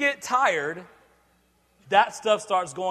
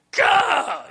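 mock frustrated gah; 7 semitones higher, 6 dB louder than usual. Frustrated outburst.

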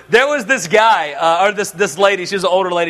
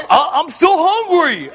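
higher, slow, put-on whiny kid voice; 7 semitones higher, slower than usual. Son answering dad at breakfast, mock whiny. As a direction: whine like a hungry boy.

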